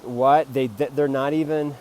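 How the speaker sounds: loud, startled What, then sputtering; mock shocked outburst. Viewer reacting loudly to the pay demand, mock shock. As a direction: blurt it out like a stunned viewer. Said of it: "you're like".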